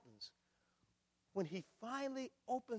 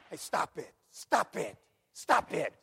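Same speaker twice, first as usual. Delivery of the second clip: stern warning; 9 dB louder, slower than usual. Acted. God warning sinner.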